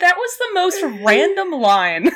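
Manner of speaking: high, loud words through laughter; laughing. Real, not acted. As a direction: exclaim it while cracking up. Cracking up at the line.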